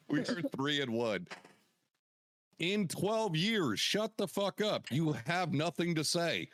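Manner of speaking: slow, high gloating jeer; taunting. Acted. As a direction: rub it in, drawn out and high. Trash talking rival branch.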